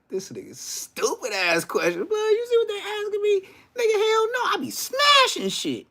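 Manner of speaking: high, indignant squeal of protest; shrill, offended hell no; mock outraged denial. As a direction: act insulted by the question. Outraged answer to jail question.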